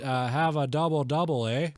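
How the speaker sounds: cheery exaggerated Canadian accent. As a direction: play up a folksy Canadian lilt. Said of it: "I like to do Canadian".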